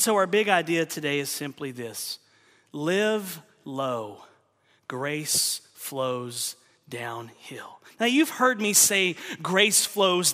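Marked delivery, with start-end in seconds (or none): 2.7-7.8 very slow, softer, deliberate; slow solemn statement. Acted. Preacher giving the big idea, slow and solemn. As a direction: lay each word down slowly.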